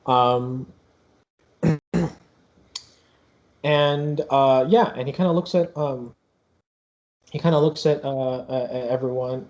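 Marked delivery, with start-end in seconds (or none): none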